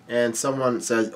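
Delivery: ordinary delivery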